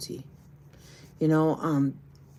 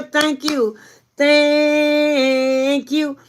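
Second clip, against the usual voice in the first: high, loud, stretched-out thank you; effusive thanks; 9 semitones higher, 5 dB louder, much slower than usual. Real, not acted. Thanking TB for still being here, high and effusive. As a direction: sing out thanks, holding the vowel long.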